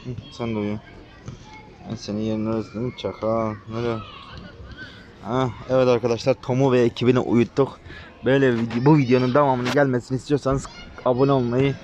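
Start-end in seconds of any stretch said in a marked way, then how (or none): 5.2-11.8 louder upbeat sign-off to viewers; youtuber outro. Real, not acted. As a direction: wrap up the video cheerfully. (izleyicilere yüksek sesle kapanış)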